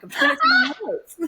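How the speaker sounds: high-pitched flustered squeal with laughter; flustered retort. Retold or imitated. Flustered student driver answering the instructor, high laughing squeal. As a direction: blurt it out very high and loud, half laughing.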